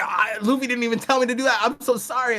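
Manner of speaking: high, fast, flustered pleading; panicked apology. Retold or imitated. Barto blurting apologies to Shanks, high and flustered. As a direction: gush out excuses like a scared fan. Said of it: "And he's like".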